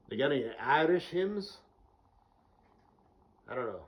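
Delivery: a loud put-on Scot mocking the Irish; mock Scottish accent. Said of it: "whole Scottish thing".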